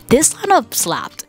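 high, bright burst of hype; excited. Real, not acted. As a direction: gush about the lineup. Hyping up the festival lineup.

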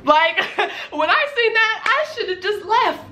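high-pitched, laughing disbelief; incredulous regret. Real, not acted. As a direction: exclaim it high, half laughing at your own mistake. Exclaiming over the staple-gun man.

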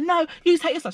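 high imitation of her angry retort; high mock outrage; mock indignant. Retold quarrel, her voice.

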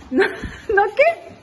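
laughing high squeal of delight. Real, not acted. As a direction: laugh through the words at her son. Mom laughing at son's words.

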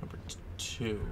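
hushed whisper to himself. Real, not acted. Gamer creeping down a dark hallway, whispering. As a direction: breathe the number out, barely voiced. Reading room numbers in dark hotel.

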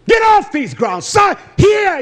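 loud, high barked command; angry order. Retold or imitated. Preacher retelling ordering his sister off the grounds. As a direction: drive her off the land.

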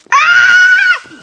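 long, piercing, very loud shriek; terrified scream. Real, not acted. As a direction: scream at the top of your range. Creeper surprises player.

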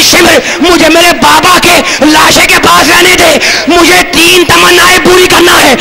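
high, wailing sermon plea; tearful wail. Acted. A weeping girl begs Shimr, wailing high. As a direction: cry out begging to stay by her father. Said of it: "बच्ची रोने लगी".

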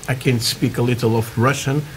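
usual speaking voice

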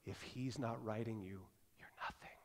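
low, soft, hushed counsel; a mother softly setting her son straight. Retold or imitated. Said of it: "She says".

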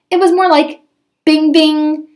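high ringing bing bing; ping it like a tiny shot. Retold or imitated. Describing the magic pistol sound, high ringing bing.

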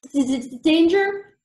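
high, loud stuttered question; frightened stammer. A narrator's reading. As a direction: stutter in alarm on the first sound. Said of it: "Billy looked faint".

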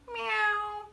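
playful high kitty denying it; cat voice. Acted. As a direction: mew it like a cat. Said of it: "meowed the cat".

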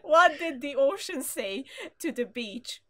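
slow, teasing setup; dad joke delivery. Acted. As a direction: drag out the setup.

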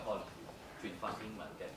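ordinary talk, nothing marked

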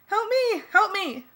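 loud, very high cries for help; shrieking plea; panicked scream. A narrator's reading. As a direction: scream it twice in terror. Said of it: "Darlene screamed".

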